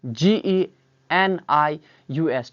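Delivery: slow, letter by letter spelling; dictating the letters of genius one at a time. Shown as a demonstration. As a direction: spell each letter out slowly for the class. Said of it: "genius को हम अक्लमंद कहते हैं".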